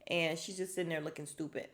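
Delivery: normal talking voice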